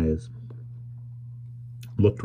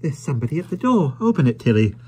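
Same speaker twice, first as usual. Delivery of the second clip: put-on young wife's voice; 8 semitones higher, faster than usual. Wife telling Tilly to open.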